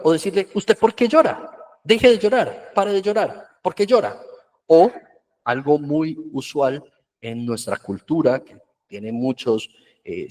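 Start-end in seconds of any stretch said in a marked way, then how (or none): 0.5-4.2 scolding parent. Retold or imitated. Parent scolding crying child.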